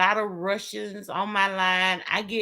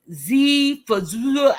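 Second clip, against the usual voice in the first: imitating a caller; 6 semitones higher, 7 dB louder than usual. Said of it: "they still be like".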